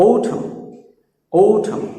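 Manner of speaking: autumn as "ou-tham"; careful autumn with aspirated t; correct pronunciation. Shown as a demonstration. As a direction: puff the t in autumn. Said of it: "সঠিক উচ্চারণগুলো দেখো".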